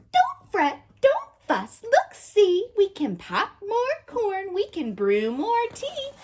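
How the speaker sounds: a tiny mouse hushing the big bear; high mouse voice calming the bear; squeaky mouse. A narrator's reading. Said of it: "Mouse squeaks".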